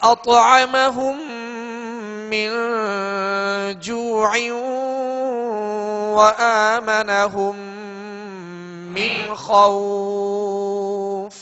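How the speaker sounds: slow, drawn-out chanted Quran verse; slow tajweed recitation. Shown as a demonstration. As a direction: chant each word long and melodic. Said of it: "teacher recites verse, chanting".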